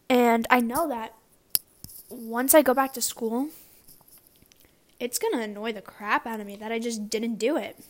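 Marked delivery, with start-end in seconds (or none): none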